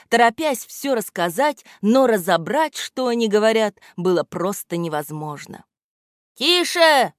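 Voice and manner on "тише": a high, loud "Тише!" to silence the crowd; sharp shout. A narrator's reading. Громотейка shouting down the chattering hares, loud. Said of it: "прикрикнул на них Громотейка".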